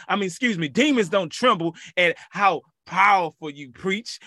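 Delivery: usual speaking voice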